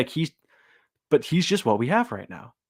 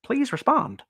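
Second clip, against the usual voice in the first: higher, meek polite plea climbing up; hammed-up timid academic asking to be heard; mock polite nerd; 10 semitones higher than usual. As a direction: play the ignored nerd adjusting his glasses. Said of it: "they adjust their glasses".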